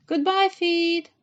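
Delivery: Alice calls goodbye to her faraway feet, slow and loud; calling out. A narrator's reading. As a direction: call out slowly, like waving goodbye. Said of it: "she called".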